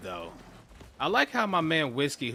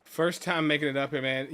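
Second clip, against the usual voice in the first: usual speaking voice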